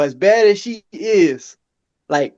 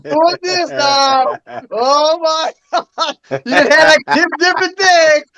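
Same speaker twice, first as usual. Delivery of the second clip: much higher, slow, amused disbelief; mock amazement; 9 semitones higher, slower than usual. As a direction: gasp in hammed-up wonder. Mock surprise at friend's projects.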